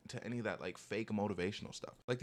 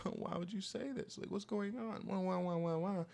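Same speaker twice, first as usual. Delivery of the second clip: much higher mock whining voice; mock crybaby whine; 9 semitones higher than usual. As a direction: imitate a whiny crier, up high. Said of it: "They're crying all the time".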